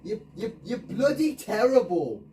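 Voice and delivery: loud, high, slowed insult; furious insult. Real, not acted. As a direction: spit it at the teammates. Insulting teammates.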